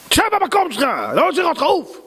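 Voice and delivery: shrill, high angry order; furious shout. Acted. Boss shouts at worker to sit and get lost. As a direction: bark him back to his seat.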